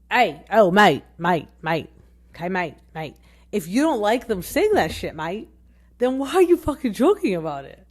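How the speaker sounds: loud, exasperated imitation telling someone off; mock confrontation. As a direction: call him out loudly, hammering on "mate". Acted confrontation aimed at Ben.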